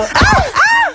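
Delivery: very high panicked scream; hammed-up scream. Driver shrieks at crashing the taxi, very high scream. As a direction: shriek as the car hits.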